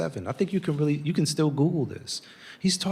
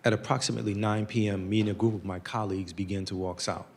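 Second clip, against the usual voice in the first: reading a stiff statement; 5 semitones lower, 4 dB quieter, faster than usual. Retold or imitated. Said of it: "what reads like".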